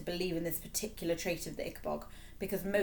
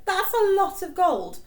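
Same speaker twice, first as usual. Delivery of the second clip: much higher, louder, slow dismayed king; 13 semitones higher, 10 dB louder, slower than usual. A narrator's reading. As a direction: balk at the sum.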